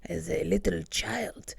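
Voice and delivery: creepy old man in a bad Russian accent, fading to a whisper; mock Russian accent, ending in a whisper. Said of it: "that's also not a Russian accent".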